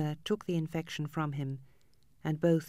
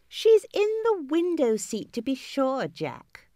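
put-on much higher, louder girl's voice, dropping on the name; a little sister telling on her cousin; tattling girl; 10 semitones higher, 6 dB louder, slower than usual. A narrator's reading.

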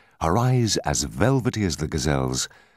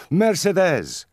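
high, ringing joyful call; delighted high-pitched hail from outside; joyous cry; 13 semitones higher, 4 dB louder, slower than usual. A narrator's reading. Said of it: "cried a voice joyously".

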